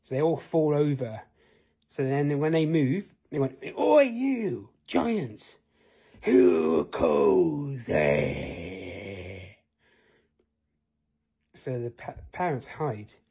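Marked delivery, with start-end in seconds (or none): 3.8-5.6 high, loud call across the field; Oi shouted up high, then giants; shouted challenge. Acted. 6.2-9.6 deep, very slow booming giant; lower, slow giant boom. Acted. Giant answers the call.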